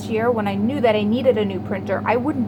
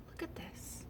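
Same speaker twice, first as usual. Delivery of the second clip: whisper; 7 semitones higher, 25 dB quieter than usual. Real, not acted. Showing the print late.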